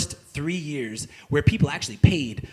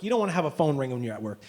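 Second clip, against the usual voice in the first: ordinary delivery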